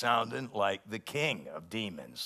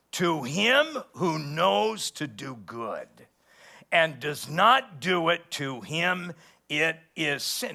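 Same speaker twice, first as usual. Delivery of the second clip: slow, loud, higher preaching voice; scripture reading; 4 semitones higher, 5 dB louder, slower than usual. Acted. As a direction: proclaim each word slowly from the pulpit. Preacher reads scripture aloud.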